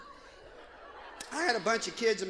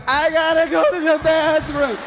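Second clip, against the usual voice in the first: put-on higher and louder, a kid who can't hold it; desperate kid wail; 7 semitones higher, 8 dB louder than usual. Kid begging a parent for the bathroom, desperate wail. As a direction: wail it like a kid about to burst. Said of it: "kid desperate for bathroom".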